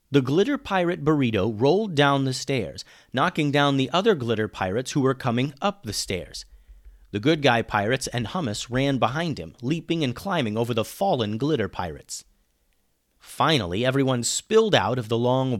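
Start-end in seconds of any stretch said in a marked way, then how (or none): none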